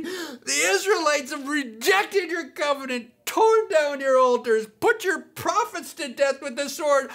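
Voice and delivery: high, whining, near-crying complaint; whiny Elijah. Acted. Pastor voicing Elijah's complaint again, whiny. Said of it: "here's how I imagine Elijah whiny".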